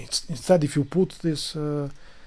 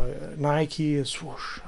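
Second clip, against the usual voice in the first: usual speaking voice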